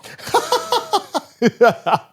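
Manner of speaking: loud, high-pitched burst of laughter; big laugh. Real, not acted. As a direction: crack up at your own trick. Laughs at his own trick.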